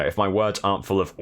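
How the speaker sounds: ordinary talk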